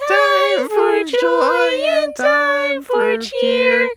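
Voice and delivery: high, very slow sung chipmunk line. Pretend. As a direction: sing it squeaky like a chipmunk. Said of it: "My voice gave out on me".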